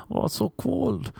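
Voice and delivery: hammed-up high girly complaint; mock girl's whine. Girl complaining in retold scene.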